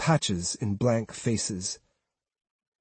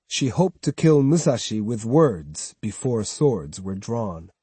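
usual speaking voice

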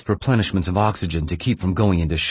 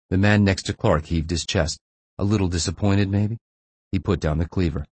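ordinary delivery, nothing marked

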